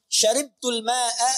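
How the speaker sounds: ma'a slowed, ending on a clear a. Shown as a demonstration. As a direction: say ma'a with fathah a. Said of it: "ma'nya pakai A". (ma'a diucapkan pelan berakhiran a)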